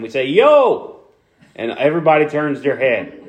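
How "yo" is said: loud street call to grab attention. Shown as a demonstration. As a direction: shout it across the block. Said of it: "like in South Philly when we try and get somebody's attention".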